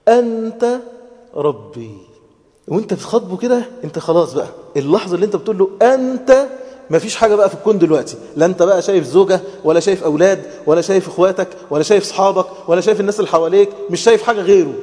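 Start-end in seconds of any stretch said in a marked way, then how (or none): 0.1-2.0 unhurried. Shown as a demonstration. Preacher showing how to address God, unhurried word by word. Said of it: "عايزك تقولها كده بالراحة". 5.8-14.7 loud, high and fast, piling up phrases; fervent. Real, not acted. Preacher urging that nothing exists but God, fervent and loud.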